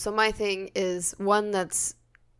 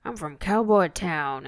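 cowboy voice; 3 semitones lower than usual. Pretend. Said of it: "our best cowboy voices".

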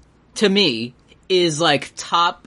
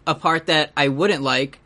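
ordinary talk

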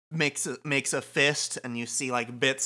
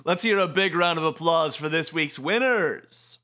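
showy host; 6 semitones higher, 5 dB louder than usual. Acted. Shiba greeting the people of Shibuya, showy. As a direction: play a grand announcer working the crowd.

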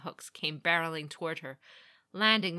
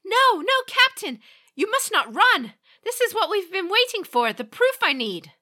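high, loud frantic pleading; desperate pleading; 14 semitones higher, 11 dB louder than usual. A narrator's reading. Meiko frantically pleading with the captain to stay. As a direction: beg him not to run, urgently.

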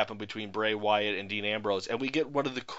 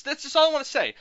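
exasperated outburst; 13 semitones higher, 6 dB louder, faster than usual. Real, not acted. Addressing Luke Harper directly.

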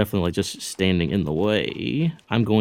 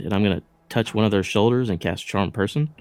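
usual speaking voice

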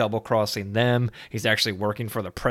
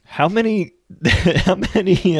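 laughing; 9 semitones higher, 6 dB louder, slower than usual. Real, not acted. Losing track while tipsy.

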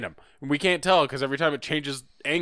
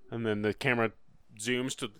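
usual speaking voice

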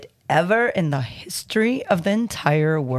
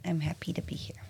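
whispering; 3 semitones lower, 11 dB quieter, faster than usual. Real, not acted. Nervous reader whispers reply.

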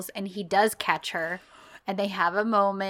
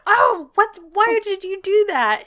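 high, loud indignant question; mock hurt protest; 10 semitones higher, 5 dB louder than usual. Man asking why she kicked him, acted as wounded protest. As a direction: protest like someone just kicked.

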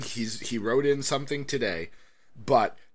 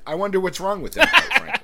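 laughing through the punchline, louder; 9 semitones higher, 9 dB louder than usual. Acted. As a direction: crack up while delivering the joke.

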